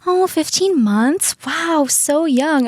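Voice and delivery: high, drawn-out mock wonder; amazed neighbor. Neighbor misunderstands the old dog's age, high gushing amazement. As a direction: coo in slow, high-pitched amazement.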